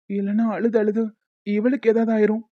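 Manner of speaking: usual speaking voice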